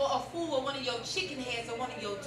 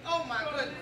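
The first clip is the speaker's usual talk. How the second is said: normal talking voice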